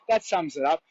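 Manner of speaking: usual speaking voice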